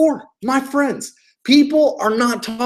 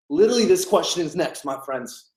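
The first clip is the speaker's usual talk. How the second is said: ordinary talk, nothing marked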